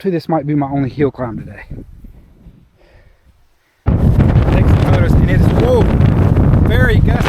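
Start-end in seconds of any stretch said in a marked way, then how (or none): 3.9-7.3 raised voice against gusts; louder and higher over the wind; shouting over wind. Real, not acted.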